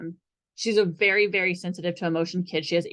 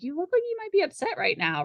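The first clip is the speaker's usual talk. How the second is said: "You look like you might be upset right now" lifted much higher, ending like a gentle question; 11 semitones higher than usual. Shown as a demonstration. Therapist shows a group the questioning inflection, pitched high. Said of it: "that tone of voice ... the inflection at the end of your voice".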